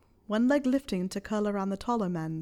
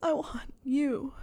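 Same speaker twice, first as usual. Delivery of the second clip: higher, slow voice, breaking; halting, fragile confession; Harry; 6 semitones higher, slower than usual. A narrator's reading. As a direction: let the voice break with longing. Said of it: "his voice breaking".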